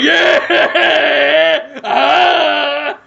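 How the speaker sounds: high hyped yell; loud cheering. Pretend. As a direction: whoop like a crowd. Cheering shout.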